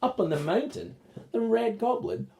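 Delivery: ordinary delivery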